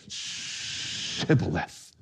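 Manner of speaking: shibboleth with a very long, hushed SH; whispery, drawn-out SH opening shibboleth. Acted. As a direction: stretch the SH of shibboleth, soft and low. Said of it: "And they would say".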